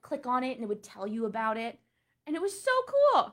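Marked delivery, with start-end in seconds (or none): 2.3-3.3 high, loud burst of delight; excited. Real, not acted. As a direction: gush about how cool it was. Excited museum memory.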